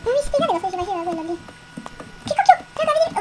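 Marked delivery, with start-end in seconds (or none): 2.3-3.2 startled. Real, not acted. Startled by something in game.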